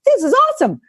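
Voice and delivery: thrilled about a bargain; excited about half-price massages; delighted. Retold or imitated. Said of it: "I'm like".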